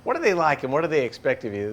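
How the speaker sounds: ordinary talk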